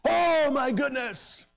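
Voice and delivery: high, slow cry of shock; hammed-up outrage; mock shock. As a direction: gasp it out in feigned alarm.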